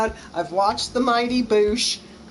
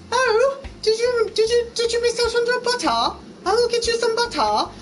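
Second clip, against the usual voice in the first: hammed-up British accent, sweet and fussy; mock British accent; 12 semitones higher than usual. Mocking polite British bakers, hammed-up high British accent. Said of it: "Your British accent".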